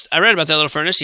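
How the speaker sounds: usual speaking voice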